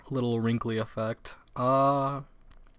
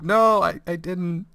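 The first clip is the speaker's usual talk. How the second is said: high-pitched retold denial; hammed-up defensive whine; mock protest; 7 semitones higher, 3 dB louder, slower than usual. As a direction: protest your innocence, voice pitched up. Retold protest against a friend's accusation.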